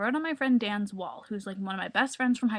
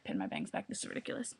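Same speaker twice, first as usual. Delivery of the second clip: under her breath, unvoiced; whispered aside; 13 dB quieter, faster than usual. Real, not acted. Fixing her bangs on camera, whispering to herself. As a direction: whisper it to yourself.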